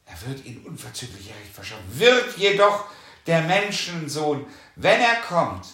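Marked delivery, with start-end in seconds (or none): 0.1-2.0 soft repeat. Shown as a demonstration. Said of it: "repeated softly".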